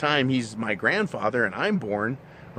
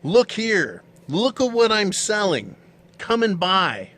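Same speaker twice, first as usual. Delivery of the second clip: mock sales pitch; 7 semitones higher, 4 dB louder, slower than usual. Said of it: "It's screaming".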